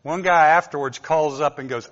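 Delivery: usual speaking voice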